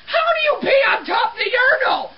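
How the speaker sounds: high-pitched cry of outraged disbelief; incredulous yell. Acted. Cleaner to whoever peed on top of urinal, incredulous yell. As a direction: shriek the question in comic bafflement. Baffled rant about the urinal.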